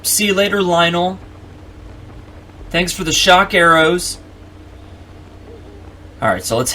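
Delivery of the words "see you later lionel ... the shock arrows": high, singsong mock-friendly goodbye; teasing farewell. Player flying off over the robbed monster, teasing goodbye. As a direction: call down cheekily, pitched well up.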